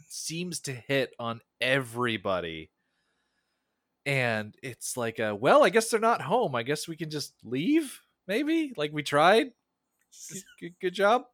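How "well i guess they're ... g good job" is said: higher, louder, tentative questioning lilt; imitated hesitant, let's-go-home chatter; mock awkward giving up. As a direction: awkwardly talk yourselves into leaving. Voicing the army giving up after pause.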